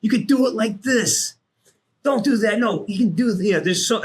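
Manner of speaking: animated mock coaching from the sidelines; urgent coaching. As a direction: urge the new guy on, high and insistent. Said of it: "I'm like, I'm like, coach".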